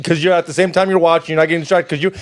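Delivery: usual speaking voice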